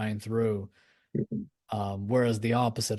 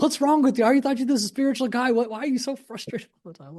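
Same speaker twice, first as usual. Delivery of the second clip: very high, loud mock-exasperated friend; high, rushed mock scolding; friends calling out; 13 semitones higher, 6 dB louder than usual. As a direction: imitate an incredulous friend in a high-pitched voice. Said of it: "friends who would call me out".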